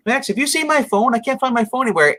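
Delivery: high-pitched imitation of a mom; fretting mom. Retold mom searching for her phone.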